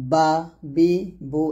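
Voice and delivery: বা, বি, বু one at a time; paced syllable drill on বা, বি, বু; lips-closed Ba. Shown as a demonstration. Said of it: "দুই ঠোঁট মিল করে".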